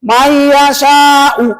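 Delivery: slow, loud, wrong merged reading. Shown as a demonstration. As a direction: stretch "Man yasha'u" slowly, merging the nun. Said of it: "Jangan gini".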